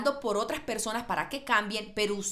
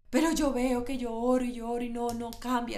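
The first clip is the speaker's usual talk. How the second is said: lightly voiced whining churchgoer; a hint of a frustrated pray-er; mimicking a frustrated believer; 3 semitones higher, 4 dB louder than usual. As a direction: play the tired complainer, a bit louder. Voicing a complaining believer.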